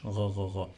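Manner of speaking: "gha" like a French r plus ah; quick repeated "gha", voiced in the throat; French-R-like sound. Shown as a demonstration. Teacher compares the sound to French, throaty r. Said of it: "It sounds like R in French".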